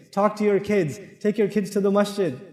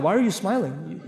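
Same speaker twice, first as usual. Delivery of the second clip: low, softer mock-shocked husband; 5 semitones lower, 6 dB quieter than usual. Husband stunned by his wife's smile, mock shocked. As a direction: act taken aback and suspicious, keeping it low. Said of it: "he'll get all shocked".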